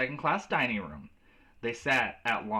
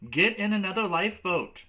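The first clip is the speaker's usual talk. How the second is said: high loud urgent yell; screaming; 8 semitones higher, 5 dB louder than usual. Acted. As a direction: yell the order desperately. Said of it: "her mother screamed".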